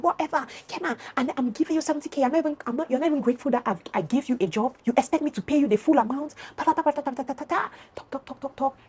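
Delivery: high-pitched dismissive mimicry; mocking imitated rant. Friend dismissing her before leaving, high mocking rant.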